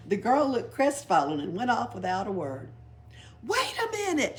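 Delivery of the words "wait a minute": very high call after her; high shrieking call. A narrator's reading. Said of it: "shrieked Pippi after her".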